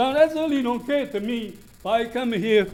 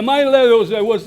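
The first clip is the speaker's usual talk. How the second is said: loud; 7 dB louder than usual. Real, not acted. Resident keeps shouting at meeting.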